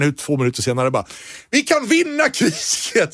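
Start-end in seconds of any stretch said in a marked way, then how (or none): 1.5-3.1 much higher, slow, dazed conviction; Rommel converted. Retold or imitated. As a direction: sell it as a brainwashed believer. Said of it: "säger Rommel".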